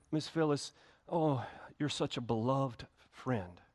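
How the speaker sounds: gentle, hushed and fond, like greeting a dear friend; a quiet, tender "you're such a beloved friend"; soft affectionate greeting. Retold or imitated. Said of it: "if I walked up to you".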